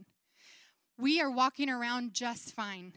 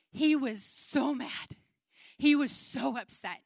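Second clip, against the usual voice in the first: slow angry emphasis; 4 dB louder than usual. Shown as a demonstration. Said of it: "deep, guttural, angry cry".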